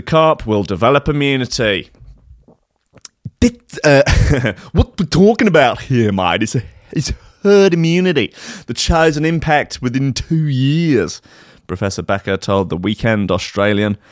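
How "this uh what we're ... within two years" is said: mock Aussie bloke. Podcast host mocks Australian scientist, hammed-up Aussie accent. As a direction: play the blokey Aussie official.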